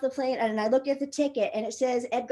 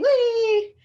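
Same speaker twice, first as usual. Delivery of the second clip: high, loud, drawn-out whee; mock thrill, pitched way up; playful whee; 12 semitones higher, 7 dB louder than usual. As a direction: squeal it like riding a slide. Acts out swinging down on belay.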